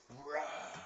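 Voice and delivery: drawn-out imitated roar; monster roar. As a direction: roar like a vampire jumping out. Imitates monster jumping out at her.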